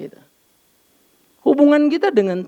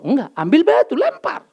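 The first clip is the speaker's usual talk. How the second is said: higher, a hint of a bold father; father's advice; 7 semitones higher than usual. Father urging son to throw a stone, mock bold. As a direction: toss off the advice, rising high.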